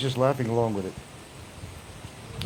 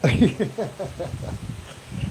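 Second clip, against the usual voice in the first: real airy laugh; real breathy laugh; 5 semitones higher than usual. Real, not acted. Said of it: "you're making me laugh now".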